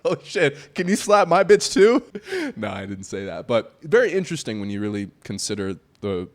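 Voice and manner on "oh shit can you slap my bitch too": high, loud, mock-eager request; hammed-up excitement, much higher and louder; jokingly excited. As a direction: blurt it out as a gleeful joke. Jokey retold excited request.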